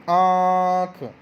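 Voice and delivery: आँख drawn out very slowly, the long आँ through mouth and nose; nasal vowel demo. Shown as a demonstration. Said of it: "नाक में से भी आवाज़ आई".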